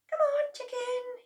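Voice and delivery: very high coaxing call; coaxing chicken. A narrator's reading. George coaxing a chicken, very high. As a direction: coax it gently.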